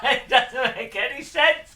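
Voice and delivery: loud, high words through laughter; laughing exasperation. Real, not acted. Builder gives up on confusing instructions, laughing in disbelief. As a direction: laugh helplessly while protesting.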